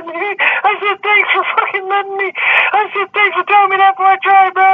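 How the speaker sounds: squeaky mock thanks to the agent; high mock voice. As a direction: pitch way up like a scared kid.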